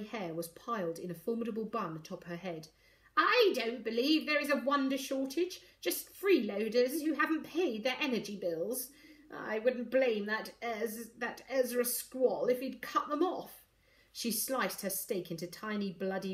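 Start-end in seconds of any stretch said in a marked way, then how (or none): 3.2-13.6 put-on savage old matriarch, higher and forceful; haughty grandmother. A narrator's reading. Grandmother complains about freeloaders at formal dinner, haughty. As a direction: sneer it as a severe grandmother.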